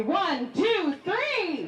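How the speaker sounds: rally the crowd with a rising count; rousing countdown. Real, not acted. Host counts the crowd into a yeehaw, rising call. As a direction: build the count up to a high call on three.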